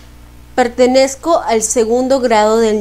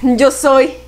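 measured, solemn recital; poem recitation; 4 semitones higher than usual. Acted.

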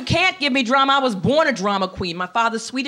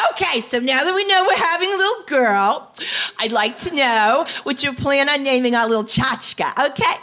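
very excited mother-in-law; 3 semitones higher than usual. Retold or imitated. Mother-in-law quizzing her about the baby's name, very excited. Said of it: "She was very excited".

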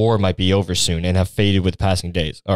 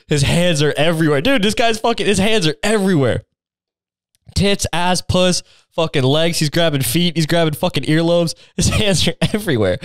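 high, gleeful, giggly hype; gleeful excitement; 7 semitones higher than usual. Real, not acted. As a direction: hype it up like a juicy scene. Excited reading, laughing.

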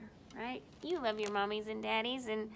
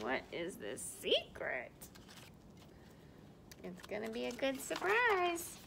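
plain reading voice

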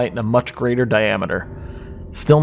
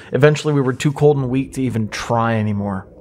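ordinary delivery, nothing marked

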